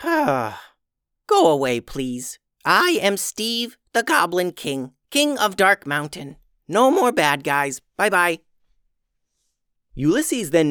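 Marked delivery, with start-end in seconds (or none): 0.0-8.4 high goblin voice, tired and firm; a little Goblin King shooing off a pest; weary goblin king. Acted. Goblin King dismisses the wizard.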